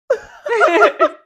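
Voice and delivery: high, loud burst of laughter; laughing. Real, not acted. Host cracking up at the lyrics, real laughter.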